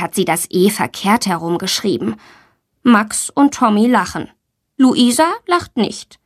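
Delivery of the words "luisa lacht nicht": plain reading voice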